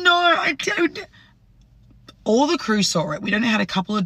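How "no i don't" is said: imitating her high-pitched tearful denial; mock crying. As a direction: put on a much higher, crying voice. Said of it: "She starts crying".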